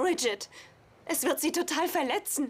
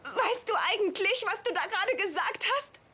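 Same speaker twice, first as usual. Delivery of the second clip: shocked disbelief; 7 semitones higher than usual. Acted. Shocked, upset outburst.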